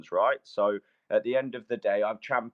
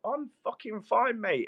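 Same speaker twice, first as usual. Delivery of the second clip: irritated snap; 10 semitones higher than usual. Real, not acted. Heated argument, told to relax.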